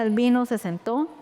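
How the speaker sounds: ordinary delivery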